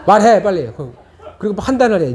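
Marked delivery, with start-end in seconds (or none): 0.1-0.7 louder, pushy command; mock demanding parent. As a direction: push the child to answer now. Parent pressing crying child. (빨리 말하라고 다그치는 부모 흉내)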